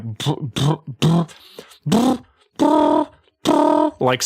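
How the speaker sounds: rolling brr lip trill sound. Shown as a demonstration. To chat, demonstrating a singer's warm-up, lip trill. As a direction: buzz the lips in a rolling brr. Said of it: "You do a rolling".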